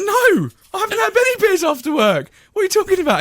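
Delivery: very high squeaky protest; mock indignant denial. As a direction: squeal your innocence, half laughing. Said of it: "I was like".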